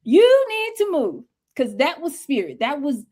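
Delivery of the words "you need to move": high, slow, drawn-out call to move; preaching urgency. Real, not acted. Telling listeners to act now, high preaching call. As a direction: urge it like a preacher.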